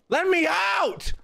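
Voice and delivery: very high, drawn-out wail; desperate cry. Real, not acted. Player trapped in the building, desperate high wail to get out. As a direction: squeal it long and high.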